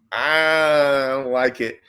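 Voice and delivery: stretched-out reluctant whine; whiny refusal. Retold or imitated. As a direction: drag the words out like a sulking kid. Refusing Luke's advice.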